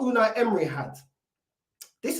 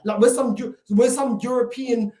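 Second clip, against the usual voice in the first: usual speaking voice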